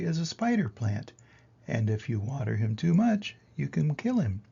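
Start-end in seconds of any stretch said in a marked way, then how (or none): none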